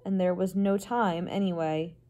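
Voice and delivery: ordinary delivery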